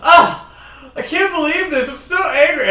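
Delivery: high, loud hammed-up outburst; mock angry bride. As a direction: yell it like a furious bride whose honeymoon is ruined. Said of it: "she's gonna be like".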